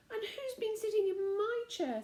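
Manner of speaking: high, light mother bear. A narrator's reading. Mother bear asking about her chair, high voice.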